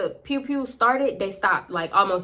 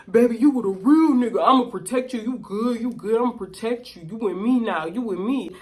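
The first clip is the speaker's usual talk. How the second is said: imitating Rodney's reassurance. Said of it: "I finally hear what he was saying".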